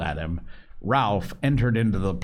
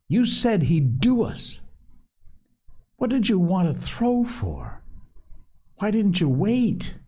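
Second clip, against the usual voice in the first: high, indignant reproach; a hot-faced boy snapping back; flushed anger; 13 semitones higher than usual. A narrator's reading. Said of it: "Jack suddenly flushed".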